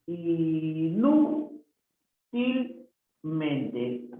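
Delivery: "inútilmente" hugely stretched, each syllable separate. Shown as a demonstration. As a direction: draw out every syllable of "inútilmente". Teacher sounds out word for accent. (separando las sílabas muy lentamente)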